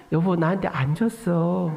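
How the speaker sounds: slow, tender denial to wife; acted-out kindly patience; sweetly gentle. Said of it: "상냥하게".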